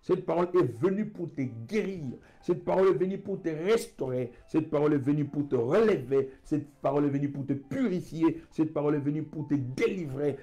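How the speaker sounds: fervent preaching. Real, not acted. Preacher declaring healing over the faithful, fervent and insistent.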